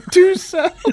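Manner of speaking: high, loud words through laughter; laughing. Real, not acted. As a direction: crack up while talking. Both laughing at her accent.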